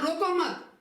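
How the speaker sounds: high रोको मत with no gap inside; रोको मत as one phrase. Shown as a demonstration. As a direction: tie मत straight onto रोको. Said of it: "और ये कह रहा है".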